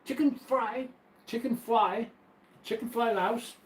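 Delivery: high, slow "chicken fly louse", with l in place of r; mock mispronunciation. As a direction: play with the typo, sliding "fry" into "fly" and "louse". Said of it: "That's the way that they speak".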